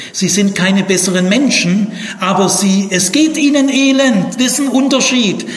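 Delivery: worked-up lecturer hammering out a distinction; impassioned insistence. Real, not acted. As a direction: drive the point home with rising pitch and volume. Teacher passionately driving home the difference.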